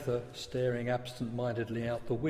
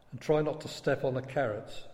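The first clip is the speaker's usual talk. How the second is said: plain reading voice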